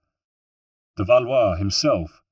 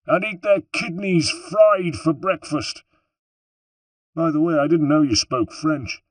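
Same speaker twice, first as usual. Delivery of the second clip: higher, grumbling threat; lightly voiced gruff Bartolomeo; 8 semitones higher than usual. A narrator's reading. Bartolomeo grumbling to Ezio about the guards, mock threat. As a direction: mutter the threat to your friend. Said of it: "muttered Bartolomeo".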